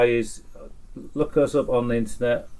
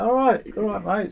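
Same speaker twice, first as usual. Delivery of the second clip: much higher, louder mock agreement; retold placating reply; 10 semitones higher, 3 dB louder than usual. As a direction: act out humouring an overkeen stranger. His retold reply to the stranger.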